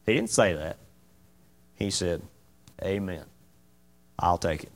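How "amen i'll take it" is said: humble acceptance. Acted. Jesus accepting blame humbly.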